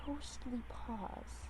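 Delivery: slow, soft eerie whisper; ghostly whisper. A narrator's reading. Narrator whispering about the ghostly kitten's paws. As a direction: breathe it like a ghost story.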